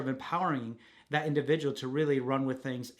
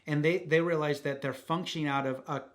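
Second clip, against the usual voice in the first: normal talking voice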